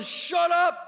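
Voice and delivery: loud, high harsh shout; shouted shut up. Retold or imitated. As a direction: bark it to silence him. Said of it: "intimidating him and telling him".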